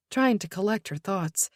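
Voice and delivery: ordinary delivery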